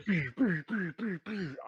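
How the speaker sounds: rapid high-pitched volley of blaster noises; quick falling pew-pew sounds; imitated cannon fire. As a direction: mouth the sound of laser shots. Imitating cannon fire streaking past.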